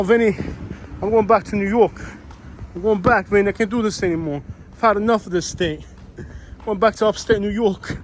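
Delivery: exaggerated New Yorker calling to Vinny; New York accent. Vlogger acting out the lady telling Vinny she's leaving, in a New York accent. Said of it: "the most stereotypical New York accent".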